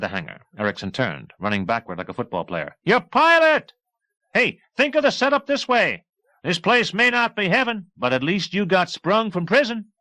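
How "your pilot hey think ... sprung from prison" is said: much higher, louder shout; calling out. A narrator's reading. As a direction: yell back while running. Erickson shouting running backward.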